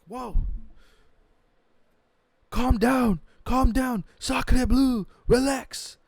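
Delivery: high, loud mock French plea to calm down; loud mock French voice pleading calm; mock French accent. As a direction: play the Frenchman begging everyone to relax. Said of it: "giant baguette walking over".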